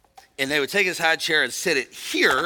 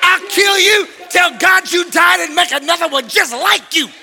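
high, loud, punched-out threat; hammed-up furious father's yell; raging dad; 11 semitones higher, 8 dB louder than usual. Preacher imitates his dad threatening him, raging shout. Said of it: "My dad said".

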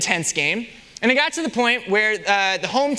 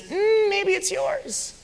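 much higher, slow insinuating tone; sly voice of shame; 12 semitones higher, much slower than usual. Acted. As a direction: tempt them slyly, drawn out. Shame whispering temptation.

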